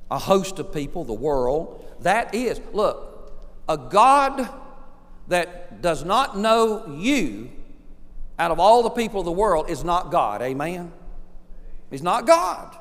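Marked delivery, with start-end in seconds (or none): none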